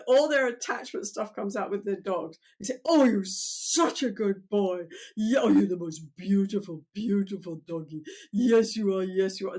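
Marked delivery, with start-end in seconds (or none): 2.8-9.6 talking to a dog. Retold or imitated. Owner cooing to their dog, doting baby talk. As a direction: coo at him like your beloved good boy. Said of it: "how people are with their dogs".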